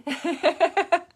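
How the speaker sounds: loud high laughter. Real, not acted. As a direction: burst out laughing at grandma. Laughs at grandma's plastic surgery.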